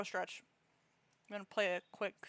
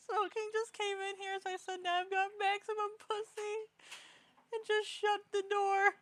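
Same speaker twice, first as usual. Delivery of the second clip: excited high retelling; 12 semitones higher, 6 dB louder than usual. Real, not acted.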